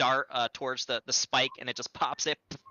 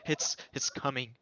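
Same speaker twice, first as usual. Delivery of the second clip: softer, halting character voice; shaking, panting; 6 dB quieter than usual. Acted. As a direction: gasp it out, worn out and trembling. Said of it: "his whole body's shaking".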